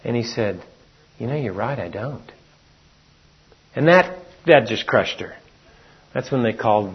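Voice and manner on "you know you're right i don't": much softer, lower admission; quiet confession. Retold or imitated. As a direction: admit it flatly under your breath. Husband's cold confession in quarrel.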